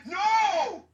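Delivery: loud, high, long refusal; outraged shout. Real, not acted. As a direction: yell it out in horror. Outraged at friend's suggestion.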